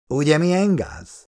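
high-pitched, mildly mocking question; teasing. A narrator's reading. Feri winds up Zoltán about having a boss, lightly teasing. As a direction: needle him lightly, pitch lifted. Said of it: "szivatta Feri".

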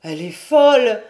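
loud, appalled outburst; horrified cry. A narrator's reading. Brother horrified by his sister's laughter, loud aghast cry. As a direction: cry it out in horror. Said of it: "Il s'écria, épouvanté".

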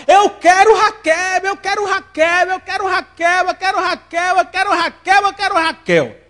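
put-on high, slow whining repetition like a child; childish craving. Preacher voicing Jacob's heart as a whining child. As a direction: beg for her like a spoiled kid. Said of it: "o tom do coração de Jacó".

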